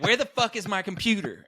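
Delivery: high, exasperated mock demand; imitated angry roommate. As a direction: play the roommate losing patience. Retold roommate demanding his computer.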